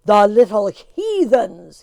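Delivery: slow, very loud outburst; furious shout. A narrator's reading. Tim shouting in fury at the empty tablecloth. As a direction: bellow it in a rage. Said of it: "he shouted".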